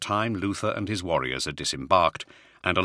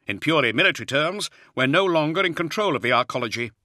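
much higher, strained colonel voice; a harried Jaeger officer reporting under pressure; Hadziel, harried officer; 8 semitones higher, 4 dB louder than usual. Acted. Officer's voice held by narrator.

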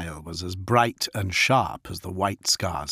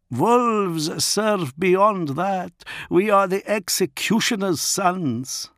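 higher, slow, solemn wolf chieftain; grim pack leader declaring the unthinkable; Fafnir the grim executioner; 10 semitones higher, slower than usual. Acted. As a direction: intone it like an oath. Fafnir's proud claim.

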